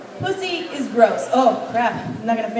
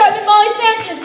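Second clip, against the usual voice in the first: ordinary delivery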